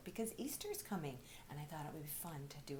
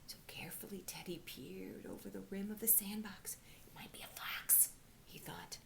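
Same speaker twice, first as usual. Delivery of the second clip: soft, breathy-hushed suspense read; hushed suspense narration; 4 dB quieter than usual. A narrator's reading. Teacher building suspense in a read-aloud, hushed. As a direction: make the children lean in, hushed.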